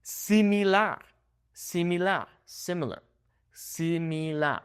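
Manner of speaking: "Similar" split as see-mee-LAR, pure vowels; Spanish "Similar" in syllables. Shown as a demonstration. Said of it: "we're just breaking it up into syllables".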